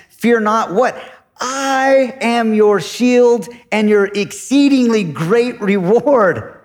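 proclaiming God's words. Retold or imitated. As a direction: declare it slowly as God's promise. Preacher voicing God's promise.

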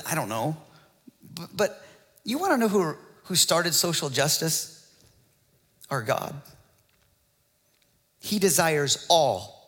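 ordinary talk

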